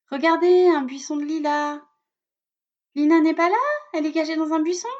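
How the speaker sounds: teasing schoolyard jeer; loud, high mocking tease; mocking taunt. Classmates mocking her name.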